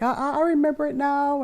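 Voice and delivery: put-on high stammering little boy; stammering kid. Son finally remembering his question, stammering high. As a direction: stutter it out like a relieved kid. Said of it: "He's like".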